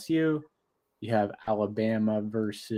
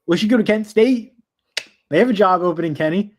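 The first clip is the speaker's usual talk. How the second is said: loud, high eager pitch; excited; 8 semitones higher, 10 dB louder than usual. Real, not acted. Friend pitching a coaching job, excited and loud. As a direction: jump at the idea, pitching it loud.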